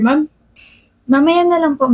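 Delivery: ordinary talk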